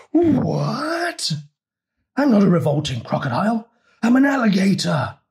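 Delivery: very high, far-off indignant yell; shouted. A narrator's reading. Alligator correcting the frogs who called him crocodile, indignant yell. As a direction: explode with offended outrage. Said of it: "he shouted so loud".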